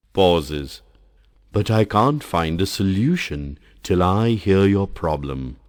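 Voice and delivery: ordinary talk, nothing marked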